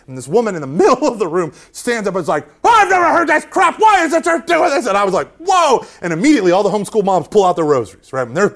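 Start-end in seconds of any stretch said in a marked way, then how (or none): 2.6-4.9 high, loud indignant outburst, imitated; outraged protest. Homeschool mom interrupting a church lecture, imitated outrage. 5.4-5.9 startled. Retold or imitated. His startled reply to outburst.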